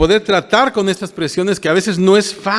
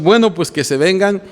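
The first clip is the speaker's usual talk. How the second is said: ordinary talk, nothing marked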